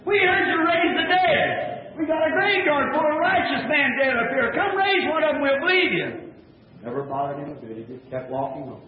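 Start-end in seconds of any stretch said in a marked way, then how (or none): 0.0-6.1 jeering shout. Retold or imitated. Priest daring the prophet to raise the dead, jeering shout. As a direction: bellow the dare like a scoffing priest.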